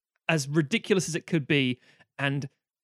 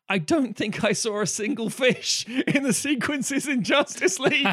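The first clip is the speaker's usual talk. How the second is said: laughing disbelief; 8 semitones higher, 4 dB louder than usual. Real, not acted. Incredulous laughing complaint.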